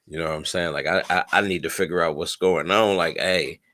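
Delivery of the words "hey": normal talking voice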